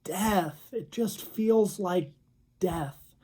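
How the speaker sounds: lightly acted crying, drawn out; imitated tearful voice. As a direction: let each phrase sink into heartbreak. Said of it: "crying with tears just streaming down her face".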